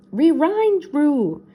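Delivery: high, loud cartoon dog; urgent doggy yelp with R sounds; Scooby warning. A narrator's reading. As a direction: blurt it out like a panicked cartoon dog. Scooby warns of ghost behind.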